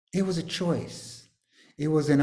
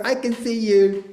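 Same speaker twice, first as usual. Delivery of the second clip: higher, louder teasing call; playful teasing; 9 semitones higher, 6 dB louder than usual. Acted. Teasing students who fibbed, playful louder call. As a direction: catch them out playfully.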